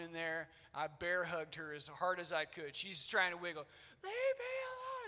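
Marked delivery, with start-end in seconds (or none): 4.0-5.1 very high squealed tantrum cry, volume held back; mimicked child's scream. Dad mimicking his bear-hugged daughter trying to wiggle, high scream. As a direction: imitate a little girl shrieking as she wriggles. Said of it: "At the top of her lip".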